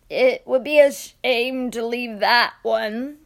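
put-on old man voice. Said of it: "said the old man".

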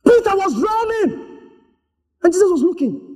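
high dramatic cry. Real, not acted. Preacher bursts out dramatically after pause.

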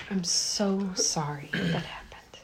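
slow, whispered condolence; soft sympathetic whisper. Acted. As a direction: gently comfort in a whisper. Consoling traumatized survivor.

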